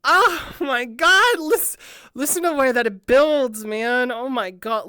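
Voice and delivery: high, loud, stretched-out amazement; blown away. Real, not acted. Listener reacting to the song's build, blown away. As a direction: gush loudly, overwhelmed by the sound.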